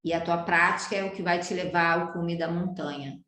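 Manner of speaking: normal talking voice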